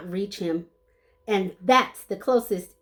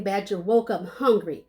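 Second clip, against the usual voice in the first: ordinary delivery, nothing marked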